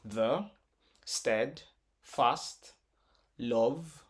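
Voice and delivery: "the steadfast love" with long pauses between syllables; broken into syllables. Shown as a demonstration. Teacher breaks this in syllables, "the steadfast love" one syllable at a time. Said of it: "break this in syllables".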